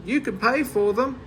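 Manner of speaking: high mocking call; taunting shout. A narrator's reading. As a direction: taunt him on the way out. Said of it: "he shouted".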